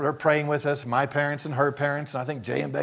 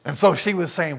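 usual speaking voice

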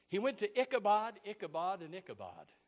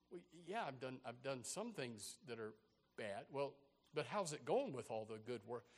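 deflated; 6 semitones lower, 12 dB quieter than usual. Acted. Deflated man, quiet exchange.

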